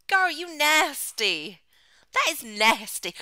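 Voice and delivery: loud, drawn-out mock disgust. Real, not acted. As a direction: tease her for being gross, slow and loud.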